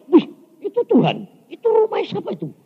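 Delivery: high-pitched, wide-eyed wonder; amazed. Retold or imitated. Preacher asking God whose house that is, amazed. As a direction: exclaim it high, acting out astonishment. Acted awe at heavenly palace.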